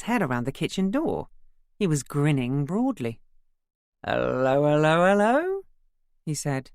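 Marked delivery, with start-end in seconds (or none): 4.0-5.6 rising, louder sing-song hellos; cheery greeting. A narrator's reading. Son greeting his mum in the kitchen, sing-song and grinning. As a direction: bounce in grinning, drunk and delighted.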